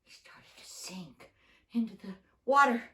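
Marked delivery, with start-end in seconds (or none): 0.0-1.3 whispered sinking line; hushed whisper. A narrator's reading. Telling the children Peter sinks, in a whisper.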